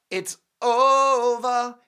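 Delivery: long, high, loud sing-song over; drawn-out it's over. Acted. Host telling viewers Harry is done, drawn-out high and loud.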